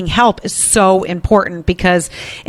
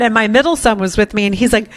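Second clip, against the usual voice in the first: ordinary talk, nothing marked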